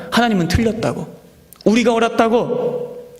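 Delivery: ordinary talk, nothing marked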